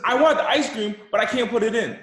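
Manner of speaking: put-on high, loud kid's plea; whining child. Kid wants ice cream but can't buckle, whining. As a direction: imitate a child protesting at full pitch. Child pleading, acted in story.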